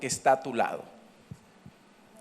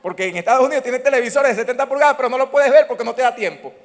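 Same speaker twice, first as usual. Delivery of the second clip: animated comic complaint; 7 semitones higher, 8 dB louder, faster than usual. Real, not acted. Animated comic aside to congregation.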